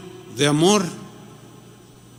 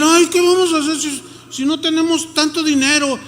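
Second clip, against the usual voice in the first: mock fretful whine, pitched up; worried disciples; 8 semitones higher than usual. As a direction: play the panicking disciples. Disciples' worried reply retold. (discípulos preocupados, voz aguda burlona)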